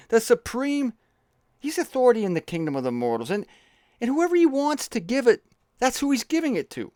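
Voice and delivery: high-pitched, fussy messenger; Woody Allen. Acted. Messenger explaining supreme authority to the king, Woody Allen-like. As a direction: hand down the decree in a comic high voice. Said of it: "that was the messenger".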